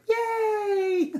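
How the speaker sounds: high, loud hurray; playful celebratory shout; cheering. A narrator's reading. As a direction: cheer like a gang of animals. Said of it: "the animals cheered".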